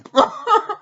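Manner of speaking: high, loud burst of laughter; real laughter. Real, not acted. Listing who beat up Cartman, bursting into laughter. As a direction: crack up at your own joke. Laughing at his own list.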